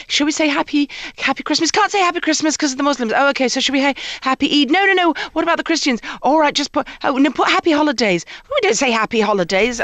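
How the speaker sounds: mock fretful committee chatter; squabbling PTA mums. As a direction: fuss over the greeting.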